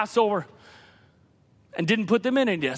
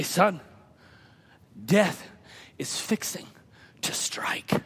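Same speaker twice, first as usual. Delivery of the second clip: low, soft, broken by heavy pauses; grave hush; 3 semitones lower, 6 dB quieter, slower than usual. Acted. Father warning his son of death, hushed and grave. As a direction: answer in an ominous near-whisper.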